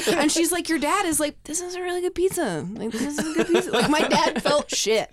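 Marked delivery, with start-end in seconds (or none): 0.7-3.7 clueless dad. Retold or imitated. Mom imitating oblivious dad.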